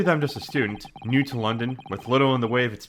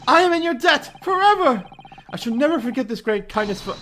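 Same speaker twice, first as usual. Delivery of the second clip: high, louder overwrought thanks; gushing gratitude; 11 semitones higher, 6 dB louder than usual. Acted. Grateful defendant hails his lawyer, high and loud. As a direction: praise him like a saviour.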